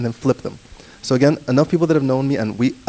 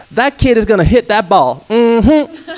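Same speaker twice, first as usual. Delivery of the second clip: much higher, louder American play-by-play; American accent; 10 semitones higher, 7 dB louder, slower than usual. Shown as a demonstration. Flipping documentaries with a loud, high American accent. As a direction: call the hit like a hyped American commentator. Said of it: "but I want an American go".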